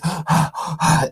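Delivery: a quick gasp for air, acted out of breath; short acted breathless gasp; demo gasp. Shown as a demonstration. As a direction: gasp as if you've just run. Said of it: "You are out of breath".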